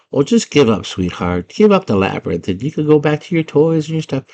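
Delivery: put-on old lady voice; old lady goblin. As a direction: coax like an old goblin woman. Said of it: "this old lady goblin".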